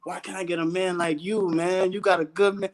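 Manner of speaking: usual speaking voice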